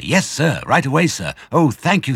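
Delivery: high, loud grateful yessing; eager child. Acted. Small badger thanking Mister Fox, high eager. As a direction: rush off thankfully.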